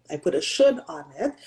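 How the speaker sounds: normal talking voice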